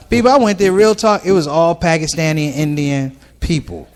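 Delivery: ordinary delivery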